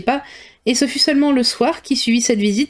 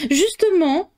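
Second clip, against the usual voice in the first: higher exclamation; exclaiming; 9 semitones higher, slower than usual. A narrator's reading. Mother exclaiming to her husband, higher and eager. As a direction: jump in eagerly. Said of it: "s'écria sa mère".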